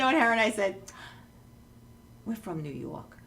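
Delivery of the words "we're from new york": low, quiet aside; sheepish, dropped right down in pitch and volume; hushed embarrassed excuse. Retold or imitated. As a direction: say it small and under your breath. Embarrassed excuse to the shop lady.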